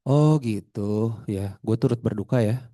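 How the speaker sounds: drawn-out "oh", then low, offhand sympathy; half-hearted condolence. Acted. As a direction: offer condolences without really meaning it. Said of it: "bodinya nggak ngikutin. Misalnya kita duduk nyender". (belasungkawa setengah hati)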